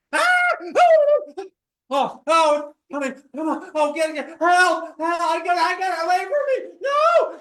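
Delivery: high, loud panicked yelling; chased by a monster. Shown as a demonstration. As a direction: scream for help while fleeing. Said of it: "a monster is chasing you".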